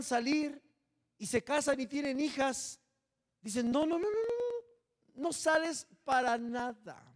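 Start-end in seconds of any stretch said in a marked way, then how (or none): 3.7-7.0 high, slow mock strict parent saying no. Mother scolding her daughter, a mock stern refusal. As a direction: play the overprotective mother forbidding her daughter.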